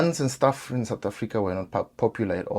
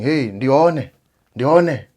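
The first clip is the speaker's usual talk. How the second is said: calling a name, louder and higher; retold shout; 4 semitones higher, 6 dB louder, much slower than usual. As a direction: shout the name across the yard. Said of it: "he started shouting".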